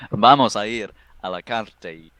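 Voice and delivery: loud opening burst of Spanish; improvised ranger speaking Spanish. Acted. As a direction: launch boldly into halting Spanish. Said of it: "I can't do an English-Spanish accent".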